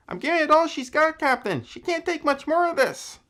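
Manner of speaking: very high, strained bad Scotty accent; hammed-up Star Trek engineer with a fake Scottish accent; terrible Scotty accent. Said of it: "that was a terrible, terrible accent".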